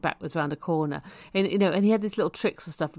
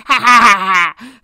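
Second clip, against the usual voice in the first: very loud imitated cackle, pitched up; maniacal cackle; 4 semitones higher, 15 dB louder than usual. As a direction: burst out with a mock maniac's laugh. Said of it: "his maniacal laugh. It was like a cackly".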